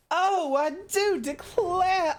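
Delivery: very high, drawn-out, laughing sob of a Southern belle; hammed-up weepy Southern belle. Streamer overdoes the swooning belle for chat, laughing. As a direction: overplay the belle into a comic wail. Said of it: "make you come down with the vapors".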